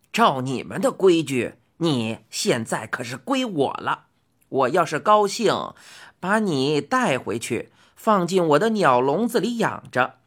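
put-on odd old man holding the upper hand; gruff bargaining old man.

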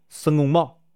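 ordinary delivery

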